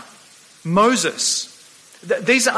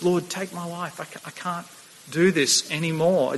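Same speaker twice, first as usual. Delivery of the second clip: much softer, a broken plea to God; hushed despairing prayer; 6 dB quieter than usual. Acted. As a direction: let it sink to almost nothing, worn out. Elijah's despair, quoted again.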